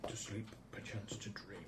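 soft theatrical whisper. Acted. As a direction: breathe the line like a dream. GM whispers Hamlet quote.

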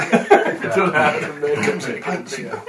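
giggling while repeating; laughing repeat. Retold or imitated. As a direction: echo it through laughter.

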